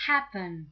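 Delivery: happen with a loud, punched HAP; stress on first syllable. Shown as a demonstration. Teacher comparing stress, happen stressed on HAP. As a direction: hit HAP hard in happen. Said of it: "happen has the stress on the first syllable".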